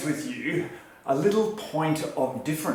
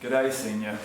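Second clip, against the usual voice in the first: low, half-hearted greeting; unenthusiastic; 4 semitones lower than usual. Real, not acted. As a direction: echo the greeting with little enthusiasm. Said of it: "That's not much enthusiasm from you".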